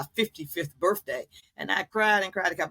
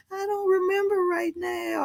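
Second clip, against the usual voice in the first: mother's confused voice pitched much higher; imitating confused mother; 14 semitones higher than usual. Daughter voicing her mother who forgot. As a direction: voice the mother, lost and unsure.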